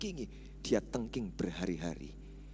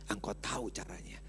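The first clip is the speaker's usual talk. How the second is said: hushed, breathy repeat; whisper; 7 dB quieter than usual. Acted. Preacher echoing his line to the congregation in a whisper. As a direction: drop to a whisper for the echo.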